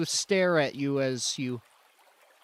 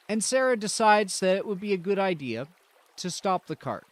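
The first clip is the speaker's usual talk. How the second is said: usual speaking voice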